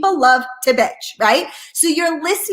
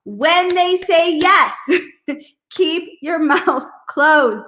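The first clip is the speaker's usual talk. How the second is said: ordinary delivery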